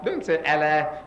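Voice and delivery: "Allah" with a flat a, as in cat; the English "Allah" he rejects; "Allah" with a flat a and a light l; wrong Allah. Shown as a demonstration.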